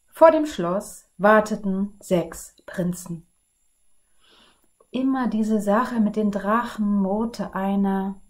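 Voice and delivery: put-on lively read-aloud for children; storybook narration. A narrator's reading. Librarian reading a fairy tale to children, lively and clear. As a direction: tell the tale to the kids with energy.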